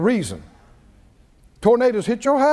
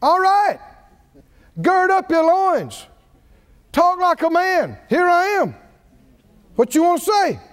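higher, commanding challenge; God's voice; 6 semitones higher than usual. Acted. Preacher voicing God demanding Job speak, higher and commanding. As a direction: call Job out like a sovereign. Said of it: "God spoke out of it and said".

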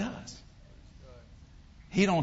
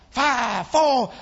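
loud, raised countdown; called-out countdown; 5 semitones higher, 6 dB louder than usual. Retold or imitated. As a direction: call the count out across the room. Acts out a called countdown to start.

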